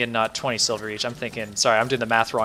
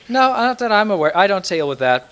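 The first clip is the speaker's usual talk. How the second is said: a hint of a trader, higher and louder; lightly voiced trader; 9 semitones higher, 6 dB louder than usual. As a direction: lightly play a shopkeeper, pitched up. Trader answering in character.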